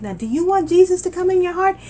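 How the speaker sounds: high, loud inviting question; high loud altar call on the bus; eager invitation. Retold or imitated. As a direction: urge them to say yes. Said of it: "And I said".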